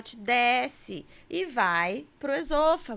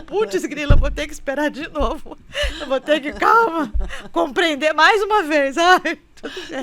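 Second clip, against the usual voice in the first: mock whining husband, a bit louder and laughing; 3 semitones higher, 8 dB louder than usual. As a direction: whine like the worn-out new dad, half laughing. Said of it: "Aí ele fala". (marido resignado, rindo e reclamando)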